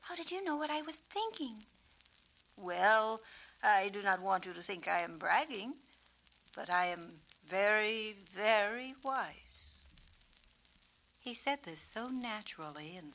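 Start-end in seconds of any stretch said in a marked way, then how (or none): 0.0-1.7 put-on young girl voice. Suki voiced in read story. 2.6-9.7 lightly voiced wise old bird; gentle wise peacock voice. Peacock character voiced in story.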